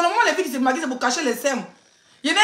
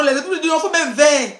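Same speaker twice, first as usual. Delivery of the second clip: ordinary talk, nothing marked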